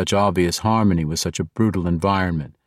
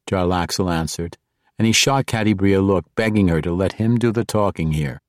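ordinary delivery, nothing marked

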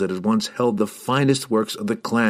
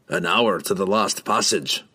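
gruff dwarf answering. A narrator's reading. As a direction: answer like a stout old dwarf. Dwarf voice in narration.

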